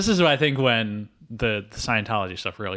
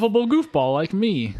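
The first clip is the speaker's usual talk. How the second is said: mock actor's boast; 9 semitones higher than usual. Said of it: "He's like".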